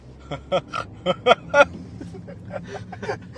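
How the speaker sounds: performed wheezing cartoon laugh; signature cartoon laugh. Shown as a demonstration. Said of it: "just laugh it".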